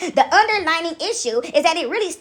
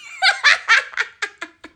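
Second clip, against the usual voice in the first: high, real burst of laughter; high cackle; 11 semitones higher than usual. Real, not acted. Laughing at the Little Sally bit, high cackle. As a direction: cackle helplessly.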